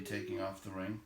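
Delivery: plain reading voice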